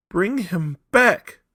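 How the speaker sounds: high, loud, slow desperate cry; old woman begging for her son. A narrator's reading. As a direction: drag the words out begging. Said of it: "cried the old woman".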